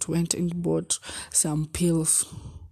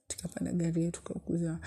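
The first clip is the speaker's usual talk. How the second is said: whisper; 8 dB quieter, faster than usual. Real, not acted. Unvoiced whisper.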